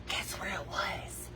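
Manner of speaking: slow hushed whisper; whispered aside. Real, not acted. Whispering to viewers, slow and hushed. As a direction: lean in and whisper the tease. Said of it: "whispered aside".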